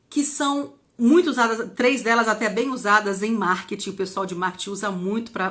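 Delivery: normal talking voice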